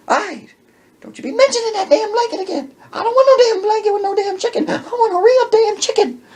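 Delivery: very high, loud, laughing-crying rant; high shrieking rant. Retold or imitated. Wife yells at Manny and Alberto, very high and loud. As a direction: shriek it at everyone. Woman voiced, shouting.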